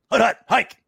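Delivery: loud, high hut-hut-hike call; quarterback calling cadence. Retold or imitated. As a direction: call the snap at the line. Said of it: "quarterback cadence at line of scrimmage".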